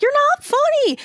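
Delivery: high, loud outburst, imitating an upset heckler; freaked-out heckling. Woman freaking out at the comedian onstage, freaked-out heckling. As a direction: shriek it like she has snapped. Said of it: "she suddenly just freaked the fuck out".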